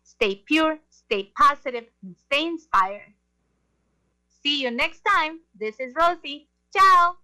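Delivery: normal talking voice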